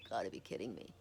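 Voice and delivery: low, hushed, stunned aside; stunned disbelief. Retold or imitated. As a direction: mutter it in retold disbelief. Retold stunned reaction, first take.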